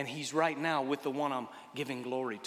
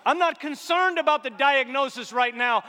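loud, building preaching, surging higher; building sermon; 8 semitones higher, 9 dB louder than usual. Acted. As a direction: climb toward a full-voiced peak. Preaching at full force.

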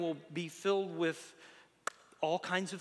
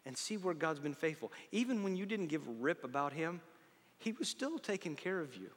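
normal talking voice

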